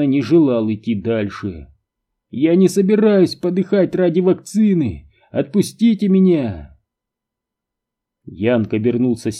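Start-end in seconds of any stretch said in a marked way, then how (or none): 2.3-6.8 high-pitched, agitated outcry; desperate protest. A narrator's reading. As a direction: protest loudly, pleading to be let go. Captive protests, demands release. (отчаянный протест высоким голосом)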